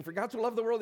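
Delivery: For God so loved the world rushed out as fast as possible; rattled off fast. Retold or imitated. A boy racing to recite for his Sunday school teacher, rattled off fast. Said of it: "trying to see who could say it the fastest".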